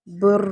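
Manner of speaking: بر with a trilled ر at the stop; بر ending in a tapped ر; rolled r. Shown as a demonstration. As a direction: roll the ر on بر, a touch of vowel after. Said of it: "اس پر تکرار ہوگی".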